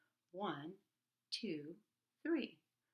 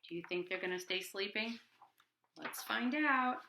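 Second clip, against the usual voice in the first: plain reading voice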